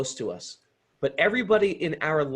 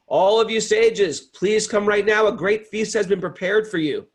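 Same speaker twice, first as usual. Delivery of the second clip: louder, higher summons across the river; calling out; 6 semitones higher, 6 dB louder than usual. Acted. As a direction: call the sages to the feast. Bhima calls sages from river.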